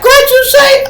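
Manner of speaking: the sister, offended, shrieking back high and loud; offended woman. Retold or imitated. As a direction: squeal back in outrage, far above his own voice. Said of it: "She like".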